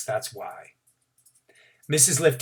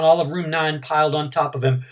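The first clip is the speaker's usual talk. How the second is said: ordinary delivery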